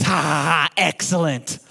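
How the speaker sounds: villainous cackle and gloating single word; Mister Burns. Retold or imitated. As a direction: imitate a scheming cartoon boss. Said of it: "the Mister Burns".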